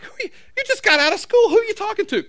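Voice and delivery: high, loud exasperated dad voice; mock exasperated parent. Dad quizzing daughter who keeps texting, high and loud. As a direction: squeak it out in disbelief. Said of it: "I'm like".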